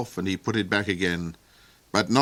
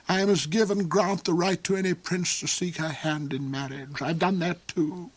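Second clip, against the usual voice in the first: soar up high and gloat over her; the Duke's high voice; 8 semitones higher than usual. Acted.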